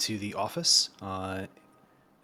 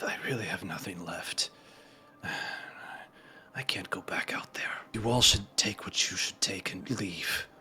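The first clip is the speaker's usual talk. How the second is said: dejected muttering; 2 semitones lower, 4 dB quieter than usual. Acted. Despairing cult member refusing to go back out, muttered. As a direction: mutter it dejectedly, slipping toward a whisper. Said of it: "he mutters under his breath".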